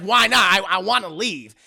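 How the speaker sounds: loud, indignant mock protest; retold protest. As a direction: protest loudly as the trapped lover. Retold lover protesting being stopped.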